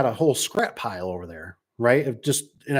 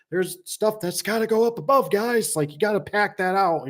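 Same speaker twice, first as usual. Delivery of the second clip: high quoted instruction; 9 semitones higher than usual. Retold or imitated.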